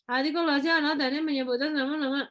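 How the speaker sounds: imitating a mumbling student, words smeared together; unclear mumbling. Teacher mimicking students' unclear presentations, mumbled speech. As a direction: mumble "nama-nama" as if mouth barely opens. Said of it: "kalau ngomong nggak jelas".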